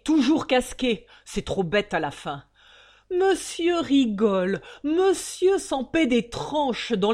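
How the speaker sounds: loud, high raging tirade; enraged father refusing to pay; furious rant. Acted. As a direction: rant at the son-in-law in fury. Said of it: "écumant, rouge de fureur".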